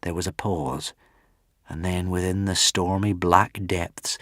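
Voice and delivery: usual speaking voice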